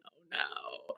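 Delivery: breathless whisper of dismay. Retold or imitated. Friend retelling her reaction to the injury text, whispered. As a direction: whisper it in mock horror. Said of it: "I was like".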